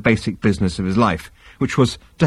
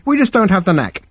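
much higher, cheery shrink; cheerful; 10 semitones higher than usual. Acted. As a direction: say it brightly, amused. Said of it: "said Halfront cheerfully".

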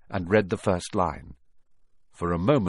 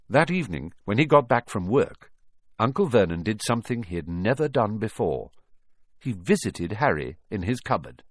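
ordinary delivery, nothing marked

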